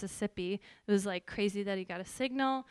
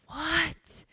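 high-pitched, startled question; incredulous higher exclamation; retold astonishment; 7 semitones higher, 3 dB louder than usual. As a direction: re-enact stunned disbelief, pitch jumping up. Shocked reply to God, retold.

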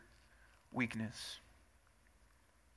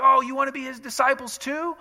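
sarcastic; 6 semitones higher, 6 dB louder than usual. Retold or imitated. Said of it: "funny and sarcastic".

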